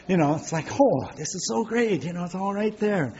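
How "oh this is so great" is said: higher-pitched gushing over a new gadget; mock delight. Preacher voicing a happy cashless payer, mock delight. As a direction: gush like a delighted shopper.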